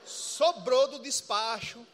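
shouted, slow, every word stretched; loud punchline. Real, not acted. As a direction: slam the punchline home slowly and loudly. Punchline about leftover ritual food. (gritando devagar a piada)

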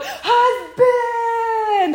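high, excited cry of "Husband!"; fangirl squeal. Retold or imitated. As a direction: squeal it like a smitten fan. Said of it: "I flip the script and just go".

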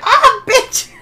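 high, loud startled yelp; pained yelp. Retold or imitated. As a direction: cry out as if just punched. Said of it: "She's like".

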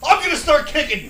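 high, loud mock-desperate threat; full-volume retold warning; retold screaming. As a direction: scream it back at the instructor, hammed up. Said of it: "So I'm screaming at the instructor".

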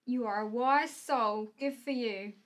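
ordinary talk, nothing marked

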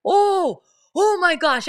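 high, loud startled oh my gosh; shocked gasp. Retold or imitated. Mother beside her daughter, reacting to the creepy video, startled and loud. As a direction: gasp it out as if the video just spooked you. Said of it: "being like".

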